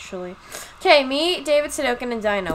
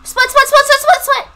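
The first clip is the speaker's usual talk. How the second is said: urgent shout; 12 semitones higher, 10 dB louder than usual. Real, not acted. Urgent call to teammates.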